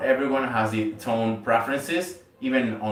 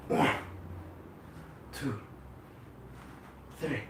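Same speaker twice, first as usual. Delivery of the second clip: normal talking voice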